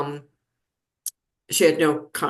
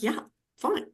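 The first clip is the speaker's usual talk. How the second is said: casual brush-off; 10 semitones higher, 5 dB quieter than usual. Retold or imitated. Mom brushing off nurses' question.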